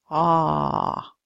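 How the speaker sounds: ordinary talk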